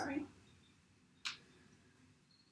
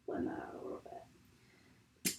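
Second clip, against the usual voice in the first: whisper; 17 dB quieter than usual. Real, not acted. Whispered aside while doing makeup.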